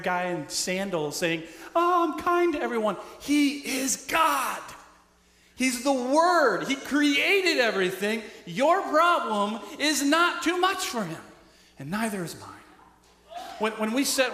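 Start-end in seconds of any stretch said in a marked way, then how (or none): 1.7-3.0 much higher, louder, sing-song mock sweetness; mock sweet Jesus. Pastor mocking the peace-loving Jesus image, sugary mock voice. 3.2-12.5 louder, higher, slow and emphatic; forceful preaching. Real, not acted. Pastor declaring Jesus is God to the congregation, full-force preaching.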